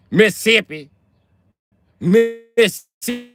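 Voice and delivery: Mississippi squeezed to "Miss-sippi", pitched high; high mocking Mississippi with a syllable dropped; local pronunciation. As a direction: exaggerate Mississippi as "Missippi", drawn out. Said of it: "That's how they say it".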